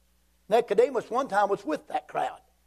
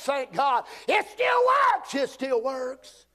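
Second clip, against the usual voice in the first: high-pitched Southern preacher fervour; fervent preaching; 6 semitones higher than usual. Real, not acted. Preacher to congregation on being born again, fervent preaching.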